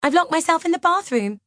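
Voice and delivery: high, frantic calling out; high-pitched alarmed cry; panicked shout. A narrator's reading. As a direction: shout it as if in danger. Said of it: "I hear her shout".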